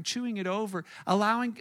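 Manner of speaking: ordinary talk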